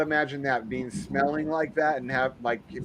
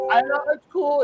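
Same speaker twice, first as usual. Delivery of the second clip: much higher breezy brush-off; imitating the coworker; 13 semitones higher than usual. Coworker dismissing the booties rule, high mock voice. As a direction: play him waving off the rule. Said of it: "So I'd always fucking tell him".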